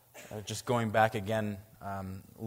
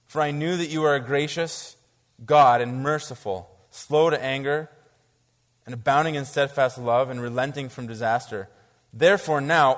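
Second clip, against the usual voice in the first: raised, louder reading of Jonah's prayer; scripture reading; 3 semitones higher, 7 dB louder than usual. Acted. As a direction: read it out with full preaching force.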